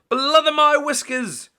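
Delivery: very high, loud startled shout; shocked shout. A narrator's reading. Mister Grinling sees seven shark fins, very high loud shout. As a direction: yell it as the sharks appear. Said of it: "shouted Mister Grinling".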